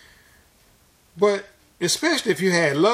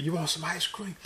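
retold whisper; 9 dB quieter than usual. Father tempting his daughter with ice cream, retold whisper. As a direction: softly imitate a coaxing whisper. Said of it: "then I whispered".

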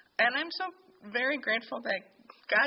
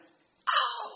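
high, startled gasp of an "oh"; sudden realisation; 14 semitones higher, 4 dB louder than usual. Retold or imitated. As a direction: let the insight hit you mid-word.

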